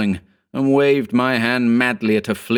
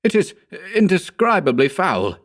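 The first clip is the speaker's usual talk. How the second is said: high, revolted exclamation; exclaiming; 10 semitones higher than usual. A narrator's reading. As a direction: recoil in disgust. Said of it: "I exclaimed".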